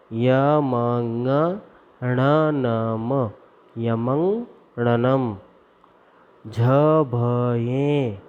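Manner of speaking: drawn-out teaching chant, syllable by syllable; slow recitation. Shown as a demonstration. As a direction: chant each sutra slowly for the class to repeat. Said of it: "आप सब मेरे साथ में बोलिए।". (धीमा सस्वर पाठ)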